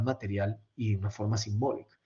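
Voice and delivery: usual speaking voice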